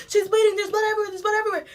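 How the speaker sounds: high, urgent, mock panic; frantic girl. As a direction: rattle it off frantically. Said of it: "the girl is frantic".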